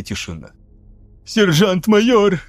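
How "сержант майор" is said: whining, raised and slow; whimpering. A narrator's reading. Punched lieutenant whimpers at the sergeant-major, whining. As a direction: whimper it through a bloodied nose. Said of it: "захныкал Паката".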